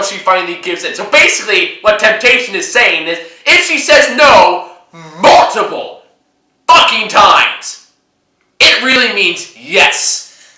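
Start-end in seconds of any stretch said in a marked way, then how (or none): 3.5-10.6 slow, loud, stressed words; emphatic outrage. Real, not acted. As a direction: hammer each word in disgust. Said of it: "indignant emphatic point".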